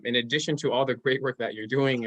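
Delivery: normal talking voice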